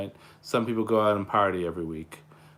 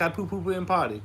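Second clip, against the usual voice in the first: put-on higher little-kid voice; toddler voice; 9 semitones higher, 3 dB louder than usual. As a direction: say it like a proud toddler. Voicing his toddler son.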